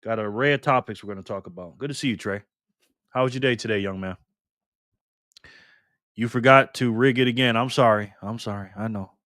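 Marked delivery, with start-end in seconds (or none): none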